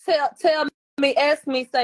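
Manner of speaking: high, loud dare to the chat; loud challenge. Real, not acted. Challenging chat to ask her.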